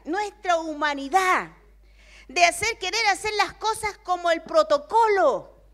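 loud, high preaching voice, slowing on key words; fervent preaching. Acted. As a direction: preach it with rising fervor. Preaches to the congregation.

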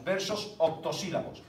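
normal talking voice

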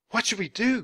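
high-pitched anxious question; crying out. Acted. Girl chased by a crowd, crying out high. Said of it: "cried Annie".

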